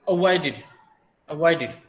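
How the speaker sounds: अवॉइडेड ending in "-did". Shown as a demonstration. Teacher drilling the word twice, अवॉइडेड closing on id. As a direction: repeat अवॉइडेड with a short i at the end. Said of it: "डेडनिंग".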